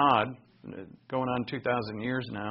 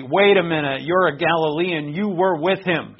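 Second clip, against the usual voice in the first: loud accusation; 3 semitones higher, 9 dB louder than usual. Acted. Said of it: "and they say".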